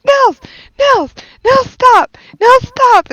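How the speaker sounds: put-on high, loud shout after a running child; calling after child. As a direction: yell his name to make him stop. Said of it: "And I'm like".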